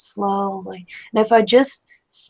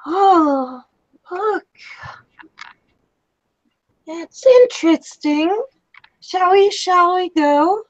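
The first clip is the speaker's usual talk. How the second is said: higher, airy and detached, a light whisper on look; dazed, dreamy woman; 9 semitones higher than usual. Acted. A shocked woman admiring the carnage, lightly dazed voice. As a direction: float above the carnage as if nothing is wrong.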